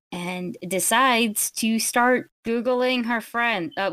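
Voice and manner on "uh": usual speaking voice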